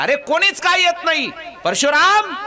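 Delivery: high, loud call for his son; a father shouting for his son; impatient shout. Acted. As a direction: yell through the house, annoyed no one comes. Father shouts for absent family.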